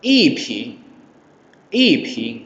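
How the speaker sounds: long falling I before ping; fourth tone on i. Shown as a demonstration. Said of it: "i berubah lagi jadi nada keempat".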